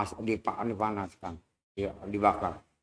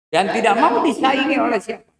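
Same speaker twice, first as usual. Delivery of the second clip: much higher, much louder, preach it out hard; thundering sermon voice; fiery preaching; 12 semitones higher, 12 dB louder than usual. Acted. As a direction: hammer the warning to the crowd. Preacher at full force.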